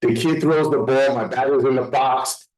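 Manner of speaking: ordinary talk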